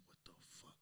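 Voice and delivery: whispered disbelief; stunned whisper. Retold or imitated. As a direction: whisper it as a baffled aside. His stunned reaction, acted.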